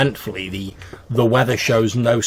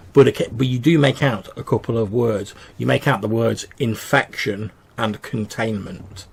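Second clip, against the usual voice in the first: ordinary talk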